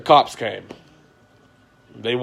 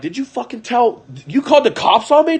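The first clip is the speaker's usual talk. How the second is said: much higher, outraged accusation; pissed off; 11 semitones higher, 5 dB louder than usual. Retold or imitated. Calling the friend who phoned the cops, outraged. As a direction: confront him, voice shooting up. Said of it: "I called Mike pissed".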